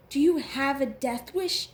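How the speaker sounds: loud, outraged narrated yell; screaming. A narrator's reading. Girl confronting Trader about his house guest, screaming. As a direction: raise the voice hard, stopping short of a full scream. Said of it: "she screams".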